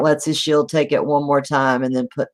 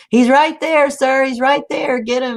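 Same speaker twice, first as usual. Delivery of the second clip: egging him on; 10 semitones higher than usual. Acted. Squire urging the knight to strike, excited high shout. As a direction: shout him on, pointing at the target.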